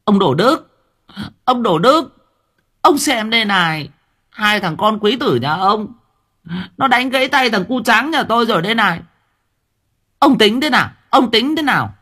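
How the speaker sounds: angry neighbour woman. Acted. Angry mother of the injured boy shouting at Đồ Đức, high and loud. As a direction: storm in demanding answers, high and loud. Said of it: "ánh mắt hằm hằm".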